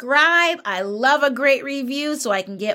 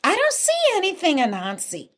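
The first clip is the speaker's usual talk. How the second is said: high, quick feigned ignorance; playing innocent; 8 semitones higher, faster than usual. Bush Deer tricks Anansi back, high innocent act. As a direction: act clueless in a lifted little voice.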